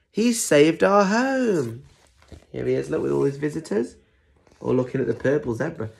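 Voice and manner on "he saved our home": high, slow, celebratory cry; cheering. Acted. Animals praising Zebra who saved them, cheering. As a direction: draw it out in triumph. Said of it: "cheered the animals".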